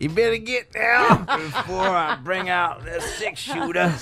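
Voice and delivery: cowboy threat through laughter; laughing cowboy voice. Pretend.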